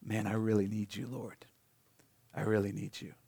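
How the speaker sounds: broken plea. Retold or imitated. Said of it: "you break down and you go".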